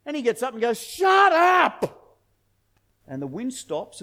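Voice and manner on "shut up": high, loud bellow; one big, drawn-out command; commanding shout. Retold or imitated. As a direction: shout it down like ordering a storm to stop. Jesus commanding the storm, retold.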